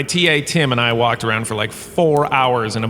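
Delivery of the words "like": normal talking voice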